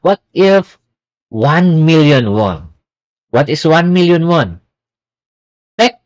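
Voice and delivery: normal talking voice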